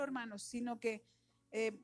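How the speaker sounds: usual speaking voice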